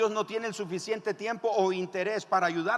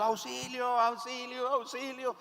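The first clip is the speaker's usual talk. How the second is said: high, thin cry held back in volume; mock cry for help; 8 semitones higher than usual. Man hanging over a cliff calling for rescue, mock cry. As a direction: play a distant, high-pitched call for help.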